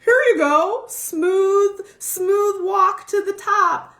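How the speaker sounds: high, loud, slow sing-song; mock the fantasy of an easy path; mock dreamy fantasy. Mocking the dream of a smooth path, sing-song. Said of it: "It's like".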